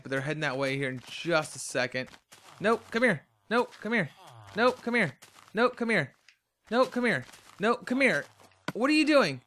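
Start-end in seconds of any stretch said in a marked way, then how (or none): 2.6-8.2 calling after villager. Real, not acted. Calling the villager back repeatedly. 8.7-9.4 exasperated. Real, not acted. Villager walking away.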